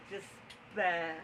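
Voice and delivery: soft, sunken echo of a wail; subdued echo. Acted. Storyteller echoes the poet's despair, softly deflated. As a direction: drop it down, quietly.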